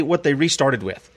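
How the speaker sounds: ordinary talk, nothing marked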